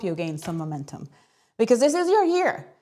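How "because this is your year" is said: excited. Real, not acted. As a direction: pitch leaps high with excitement. Hyping up the audience.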